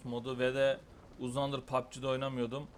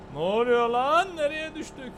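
much higher, loud shock; startled; 13 semitones higher, 10 dB louder than usual. Real, not acted. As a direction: gasp out in surprise. Sudden fall.

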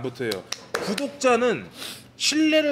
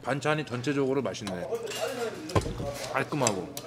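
chewing between words; eating while talking; mouth full. Real, not acted. Seen 1: Left speaker eats side dishes with chopsticks, mouth working throughout.

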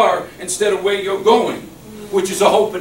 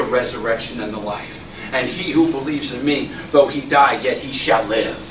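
reassuring Jesus voice; 8 semitones lower, 3 dB quieter than usual. Acted.

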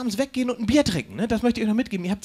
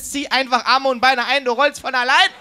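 put-on louder, slow call to the child; mock call out; 4 semitones higher, 6 dB louder than usual. Comedian calling out advice to the child on stage in a mock shout. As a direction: coach the chubby kid from the audience, half-joking. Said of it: "zurufen wollte".

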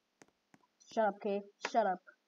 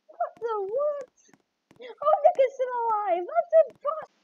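high-pitched outraged disbelief; stunned indignant gamer; 13 semitones higher, 5 dB louder than usual. Real, not acted. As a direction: squeal that it can't be true. Disbelief at enemy alive.

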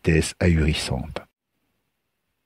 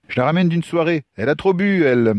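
higher-pitched, defensive explanation; hurried excuse; 10 semitones higher, faster than usual. Acted. Lucas excuses the girl to a drunk stranger, defensive. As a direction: explain it away in a high voice.

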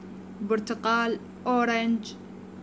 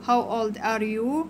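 put-on "How old are you?" spoken slowly, word by word; slow modelled question; much slower than usual. Shown as a demonstration. Teacher modelling the question for children, slow and clear.

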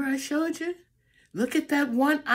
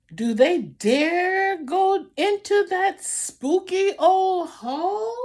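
higher, louder suspenseful question; rising suspense; 6 semitones higher, 3 dB louder than usual. Acted. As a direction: build the tension for the kids. Building suspense in picture book.

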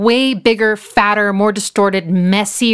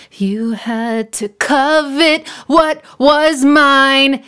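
slow, drawn-out, half-sung line with a touch of anger; mock-angry half-sung line, drawn out; pissed-off imitation; 4 semitones higher, 3 dB louder, much slower than usual. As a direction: rise up from underneath, slowly and with mild menace. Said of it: "it really sounds pissed off".

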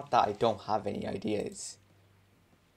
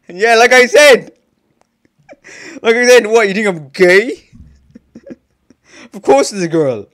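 higher, much louder laughing protest; 9 semitones higher, 16 dB louder than usual. Acted. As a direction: shout it through laughter, half outraged. Indignant character reply, laughing.

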